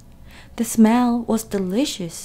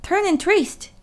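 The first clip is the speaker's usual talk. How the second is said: much higher honking goose; 10 semitones higher than usual. A narrator's reading. Goose honking at Wilbur, high. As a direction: honk it out. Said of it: "honked the goose".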